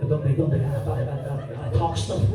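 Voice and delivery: lightly put-on Spanish accent; low mock Spanish chatter. Imagined Spaniard from Liverpool chatting, mock Spanish accent. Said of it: "but they're Spanish".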